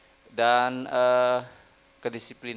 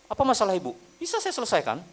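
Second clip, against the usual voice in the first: know-it-all clerk; 8 semitones higher, 5 dB louder, faster than usual. Retold or imitated. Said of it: "Oh, macam betul ya".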